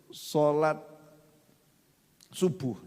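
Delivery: ordinary delivery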